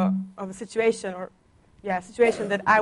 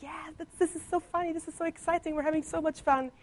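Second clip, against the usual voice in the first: much higher, giddy schoolkid chatter; excited classmates; 8 semitones higher, 4 dB quieter than usual. Retold or imitated. Classmates gushing about their fun language lessons, high giddy voice. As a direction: sound like teens who love a new subject.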